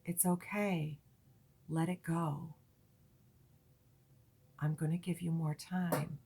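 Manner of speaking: soft guiding voice telling the listener it's okay; soft-spoken. Retold or imitated. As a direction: soothe someone through a hard moment, softly. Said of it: "her very soft voice says".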